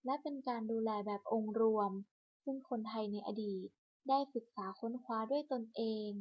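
ordinary delivery

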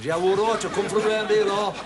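put-on a touch of a rough Midlands accent, jokey; mock local accent. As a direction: poke fun at the scruffy lads with a Midlands twang. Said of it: "Not like them other two scruffy lads".

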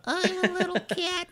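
very high, tiny kitten voice; little cat voice. Shown as a demonstration.

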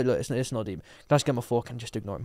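soft, low, calming; hushed polite request; comforting. Retold or imitated. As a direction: quietly reassure and steer past it. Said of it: "I've comforted her".

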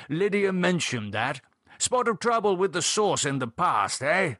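put-on pry jovially, old-chap style; hearty Gerald. Gerald raising David's drinking past, heartily tactless.

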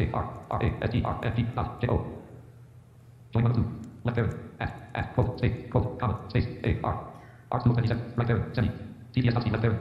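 drawn-out synthetic voice; slow robotic screen reader. Real, not acted. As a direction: read it like a slowed screen reader. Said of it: "It'll do capitals in a higher pitch".

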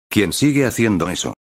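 ordinary delivery

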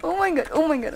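panicked. Real, not acted. Panicking between zombie and skeleton.

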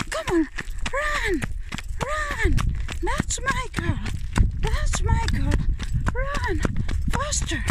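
very high, excited calls; high-pitched praise and urging; urging a dog on. Real, not acted. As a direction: cheer the running dog on.

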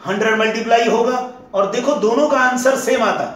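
ordinary talk, nothing marked

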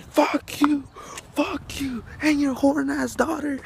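high-pitched mock yelling; imitated yelling.

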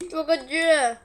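long loud pained groan; drawn-out burning cry. Real, not acted. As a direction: stretch it out, mouth on fire. Pepper burning, second cry.

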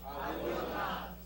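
breathless slow whisper of the promise. Acted. Pastor to congregation, whispering God's promise. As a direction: drop it to a hush, slow. Call and response, last time hushed.

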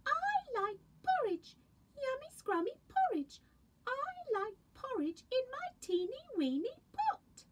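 put-on high sweet baby bear. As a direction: squeak it like the littlest bear. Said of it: "and a Baby Bear voice".

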